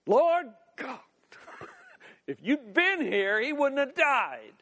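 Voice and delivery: exasperated outburst, pitch jumping up; yelling confrontation. Acted. Martha confronts Jesus over her brother's death, yelling. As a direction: snap it in his face, punching the words. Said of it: "and just yelling at him".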